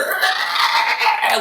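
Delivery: drawn-out raspy imitation screech; mock scream of sizzling creatures; burning vampire screech. Dozens of vampires burning to a crisp, screeching. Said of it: "dozens of vampires just going".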